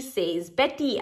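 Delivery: plain reading voice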